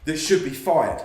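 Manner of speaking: usual speaking voice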